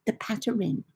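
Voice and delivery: PATTERN slowed into "pat-uh-rin"; husband's mispronunciation. Retold or imitated. Husband explains to son, imitated mispronounced PATTERN as pat-uh-rin. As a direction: mimic the teasing over-pronounced PATTERN, last syllable RIN. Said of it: "Bob joins in with".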